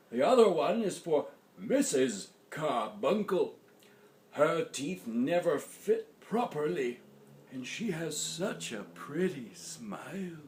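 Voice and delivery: lightly voiced old man, softening at the end; a hint of grandpa, getting tender. A narrator's reading. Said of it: "replied Grandpa".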